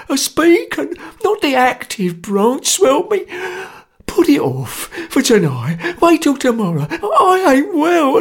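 high, whimpering, sobbing plea; whining plea. A narrator's reading. Sotcher whines and sobs, begging Jerry to let him go. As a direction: beg for mercy through tears.

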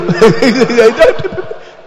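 big burst of laughter; real hearty laughter; loud laugh. Real, not acted. Laugh after the mock call.

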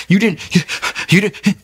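high, halting panicked stammer; re-enacted freak-out, broken and high-pitched. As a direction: act out being too panicked to finish. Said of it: "I'm freaking out".

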